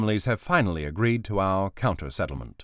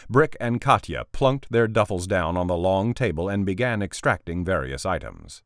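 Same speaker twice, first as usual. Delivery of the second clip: usual speaking voice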